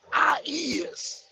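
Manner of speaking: whispered mockery. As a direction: breathe "I is" scornfully. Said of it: "respect for the goddamn language".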